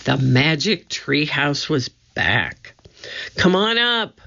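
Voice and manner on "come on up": shout. A narrator's reading. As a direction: shout down to him to climb. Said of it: "Annie shouted".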